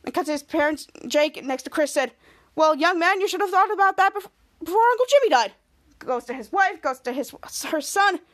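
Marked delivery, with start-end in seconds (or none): 2.5-5.5 higher scolding, louder at the end; parental scolding. Acted. Father to his son about planning ahead, scolding. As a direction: tell off a son, rising in pitch.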